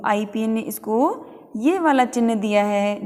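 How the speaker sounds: normal talking voice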